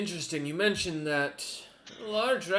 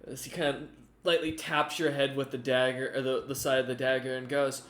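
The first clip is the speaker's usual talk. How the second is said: ordinary delivery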